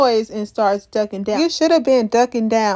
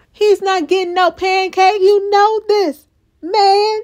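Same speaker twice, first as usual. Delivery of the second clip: high, louder scolding; exasperated protest; 8 semitones higher, 4 dB louder than usual. Real, not acted. Viewer telling Jim to stop lying, exasperated. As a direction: protest as if the character can hear. Teller argues with the character.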